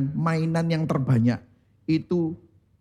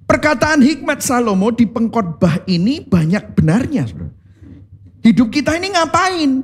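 high-pitched, forceful preaching; eindringliche Predigt; 8 semitones higher, 4 dB louder than usual. Real, not acted. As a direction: drive each point home to the congregation.

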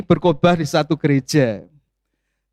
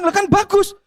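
surprised; 13 semitones higher than usual. Real, not acted. Speaker surprised at the story.

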